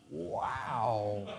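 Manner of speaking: soft, low, drawn-out wow; hushed amazement. Retold or imitated. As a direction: breathe out quiet awe, retelling it. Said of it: "I'm like".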